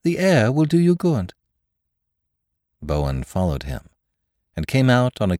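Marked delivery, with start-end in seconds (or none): 0.0-1.3 high, clear call from above; raised, carrying invitation. A narrator's reading. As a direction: call down to someone below. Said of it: "he called".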